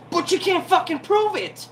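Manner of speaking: real frustrated yell; exasperated shout. Real, not acted. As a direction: burst out at them in frustration. Exasperated rant about the trial.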